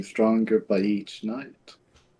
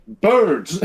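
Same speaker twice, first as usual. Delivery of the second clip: excited shout; 7 semitones higher, 7 dB louder than usual. Real, not acted.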